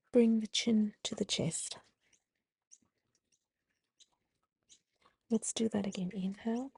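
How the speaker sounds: gentle yoga instructor voice; guided breathing cues. Acted. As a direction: talk them calmly through each breath. Leads the cat-cow flow.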